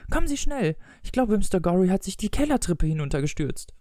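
high-pitched acted wail. A narrator's reading. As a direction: call out plaintively, pitched high. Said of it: "jammerte sie". (jammernd, mit hoher Stimme)

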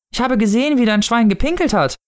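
high, thrilled little voice; excited child. A narrator's reading. Child reporting to the Snow Father, high excited voice. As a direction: report it as the biggest event ever. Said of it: "als wäre es das mit Abstand aufregendste Ereignis".

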